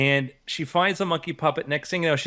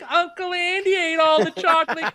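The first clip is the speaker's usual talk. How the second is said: much higher, louder squeaky monkey-puppet voice; high-pitched puppet voice; 5 dB louder, slower than usual. Retold or imitated. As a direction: squeak out an accusation like a little girl's puppet. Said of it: "doing a little high-pitched voice".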